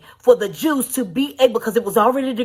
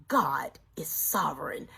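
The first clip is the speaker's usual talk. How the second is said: much softer, breathless reverence; awed whisper; 9 semitones lower, 13 dB quieter than usual. Real, not acted. Preacher closing the sermon to the church, reverent whisper. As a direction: whisper it in awe. Quiet sermon close on God.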